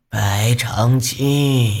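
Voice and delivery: slow, hoarse, commanding call; hoarse woman's call. Acted. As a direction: call the name with cold authority. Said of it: "一个嘶哑的女人的声音".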